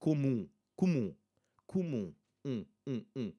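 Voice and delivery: comum ending in nasal 'oo', no closed m; nasal final U. Shown as a demonstration. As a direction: model 'comum', then isolate the nasal 'mm' with lips open. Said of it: "not pressing my lips".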